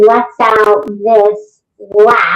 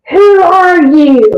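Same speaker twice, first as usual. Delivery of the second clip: high, slow, raised demand; possessed man's challenge; 7 semitones higher, 4 dB louder, slower than usual. Retold or imitated. Possessed man confronting the approaching investigator, demanding. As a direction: challenge an intruder, stretching each word. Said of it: "He goes".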